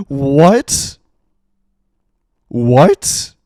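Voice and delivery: loud, rising, incredulous yelp; stunned, loud double take; shocked disbelief. Real, not acted. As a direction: blurt it out in disbelief, voice shooting up. Shocked at what was read.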